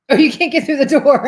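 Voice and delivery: laughing. Retold or imitated. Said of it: "Mark says".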